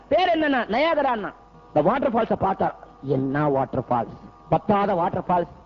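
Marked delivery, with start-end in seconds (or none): 3.0-5.5 low, unimpressed grumble; underwhelmed, pitch dropped low; dismissive. Acted. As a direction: shrug off the famous waterfall in a much lower voice. Narad belittles Niagara as a leak.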